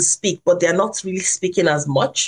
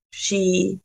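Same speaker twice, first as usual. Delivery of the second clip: ordinary delivery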